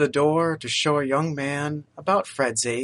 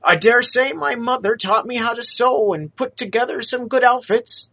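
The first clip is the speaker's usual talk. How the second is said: higher, louder humble street vendor voice; a poor young man, eager to please; lightly voiced humble lad; street lad Topper; 9 semitones higher, 5 dB louder than usual. Topper names skills.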